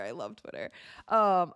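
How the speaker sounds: ordinary talk, nothing marked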